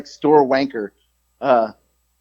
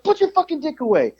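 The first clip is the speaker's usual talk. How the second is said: very high, softer strangled outcry; alarmed order; 14 semitones higher, 5 dB quieter than usual. Retold or imitated. Employee ordering the old man to cover up, alarmed. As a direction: re-enact exasperated panic up in a high voice.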